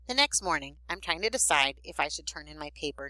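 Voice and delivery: ordinary delivery, nothing marked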